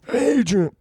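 high, abandoned attempt at a Rocky impression; Sylvester Stallone. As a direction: try out a movie boxer's call. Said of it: "do a serviceable Sly".

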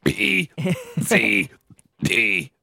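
burped letters, one belch each; burping the alphabet. Shown as a demonstration. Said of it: "Rick Sanchez burping the alphabet".

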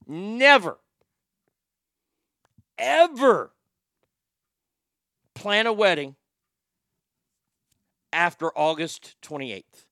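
slow, spaced-out stern warning; emphatic warning. Acted. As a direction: hammer the warning word by word. Emphatic warning to wedding planners.

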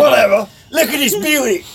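high, mock Australian-turned-English voice; faux-English accent. Co-host joining the accent joke, squeaky faux-English voice. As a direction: attempt Australian and land on English. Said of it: "That's more English".

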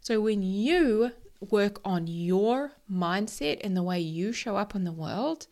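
normal talking voice

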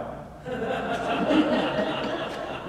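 high, breathy burst of laughter; delighted laugh. Real, not acted. Pastor recalling the teenagers walking in, delighted laugh. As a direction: laugh at the memory.

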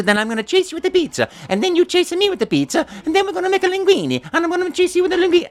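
very high, excitable Italian cartoon plumber; squeaky, breakneck improvised Italian patter; Mario. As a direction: babble joyfully high and fast, in a cartoon Italian accent. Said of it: "I went".

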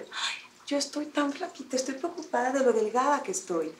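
a soft, hammed-up show of pity for her own thinness; quiet, mocking take on the coworker's complaint; mock thin-woman whine. Mimicking the coworker bragging about being skinny, mock whine.

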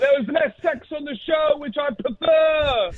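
sustained shouted TV review; yelling caller. Real, not acted. Caller reviews a TV show for hosts, yelling. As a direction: deliver casual opinions at a yell. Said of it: "I love when you just yell at us".

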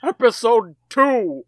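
slow, loud announcer call; fighting game announcer. Acted. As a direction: drag it out like an arena announcer. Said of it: "do the intro and the announcer voice".

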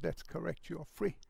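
a quiet, tender confirmation; tender reassurance. Retold or imitated. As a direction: reassure her almost under your breath. Man gently reassures her.

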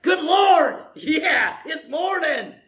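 high, loud, delighted cheer; hammed-up happy wake-up cry; joyful morning shout. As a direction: shout it like the day is a gift. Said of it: "the other one is".

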